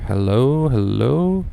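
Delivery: blasting hello into a new mic; booming mic check that clips; way too loud mic test. Real, not acted. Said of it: "that's way too loud".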